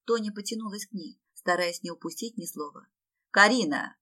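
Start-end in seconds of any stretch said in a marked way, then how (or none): 3.3-4.0 loud, cross call of a name; acted angry shout; angry bark. A narrator's reading. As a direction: bark the name as a sharp reprimand. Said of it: "рассерженно гаркнул Ратмир".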